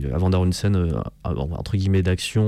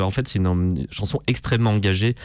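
ordinary talk

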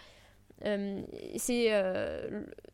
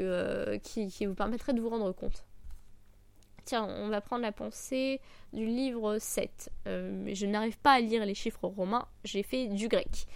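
normal talking voice

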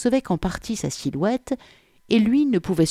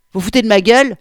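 loud, raised, affronted question; indignant challenge; 6 semitones higher, 11 dB louder, much faster than usual. A narrator's reading. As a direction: snap back at someone mocking you. Policewoman reacts to being mocked. (indignation lancée fort, plus aiguë)

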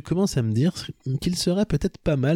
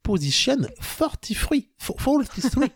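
put-on "Position forty-three" slow and much higher, French-accented; emphatic French accent; 10 semitones higher, slower than usual. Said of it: "listenez bien ce que je dis".

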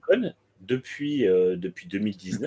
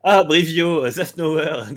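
hammed-up boss voice; 7 semitones higher, 7 dB louder, much slower than usual. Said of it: "c'est genre".

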